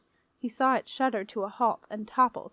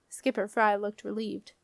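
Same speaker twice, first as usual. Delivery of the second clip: ordinary delivery, nothing marked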